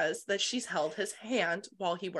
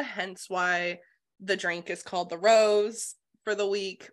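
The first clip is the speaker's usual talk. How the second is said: ordinary delivery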